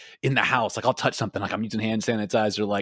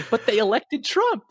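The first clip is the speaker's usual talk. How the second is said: imitating the child in a raised voice; high-pitched mimicry; 11 semitones higher than usual. Retelling the Dutch child's comeback to her mom, high-pitched imitation. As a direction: pitch it way up, lightly mocking. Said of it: "she's just like".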